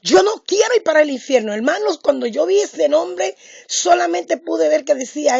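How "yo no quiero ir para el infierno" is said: high, loud frightened cry to the Lord; frightened plea. Retold or imitated. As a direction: beg in terror, voice leaping up. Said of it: "Yo dije". (súplica aterrada, aguda y fuerte)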